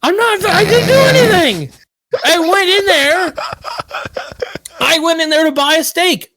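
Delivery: high, loud whiny protest; half-crying indignant whine; mock outrage. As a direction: play the wronged innocent. Accused of victim blaming.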